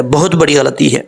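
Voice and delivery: ordinary delivery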